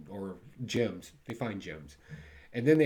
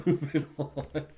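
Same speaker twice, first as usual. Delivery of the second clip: laughing through the words, louder and higher; 5 semitones higher, 5 dB louder, slower than usual. Real, not acted. Reviewer shrugging off a bad comic, laughing. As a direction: laugh it off and move along.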